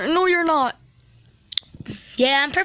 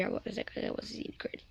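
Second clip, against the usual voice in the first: whisper; 5 semitones lower, 17 dB quieter, faster than usual. Acted. Whispered reply about the credits.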